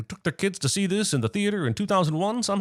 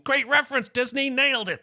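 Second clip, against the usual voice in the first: high-pitched fan cheer at normal volume; put-on preteen excitement, pitched way up; mock cheering at the screen; 9 semitones higher than usual. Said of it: "yelling at the screen".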